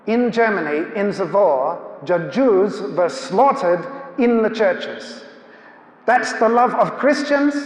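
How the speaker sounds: put-on slow, deliberate German accent. Elderly German emigrant confronting a creationist speaker, German accent. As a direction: imitate an accusing German man, rising at the end. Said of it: "he spoke with a German accent".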